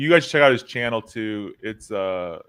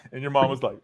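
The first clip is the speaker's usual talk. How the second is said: ordinary talk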